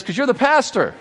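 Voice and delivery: a touch of exasperated mother; lightly voiced mother. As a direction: land the joke as the mother. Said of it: "She says".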